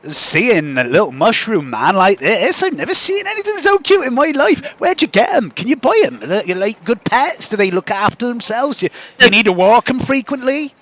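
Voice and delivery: high, lilting gush of eager questions; swooping, delighted shopkeeper voice; smitten halfling woman. Acted. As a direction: excitedly fuss over a cute pet. Game master plays excited halfling woman.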